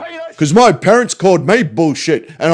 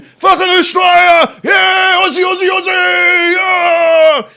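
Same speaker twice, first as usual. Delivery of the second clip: mock patriot yob; 10 semitones higher, 3 dB louder than usual. Streamer mocking a retweeter as a yobbish patriot, shouting ever higher. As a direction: play the yob, climbing into a hammed-up yell.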